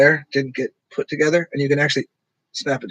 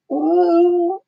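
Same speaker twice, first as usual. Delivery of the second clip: high hooting ooh, much higher. Retold or imitated. Asking what animal makes this high ooh. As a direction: hoot like an emu. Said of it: "what is the sound is that".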